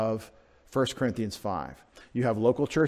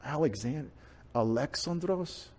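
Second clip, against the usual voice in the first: puzzled reply; 8 dB quieter than usual. Acted. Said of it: "he would be like".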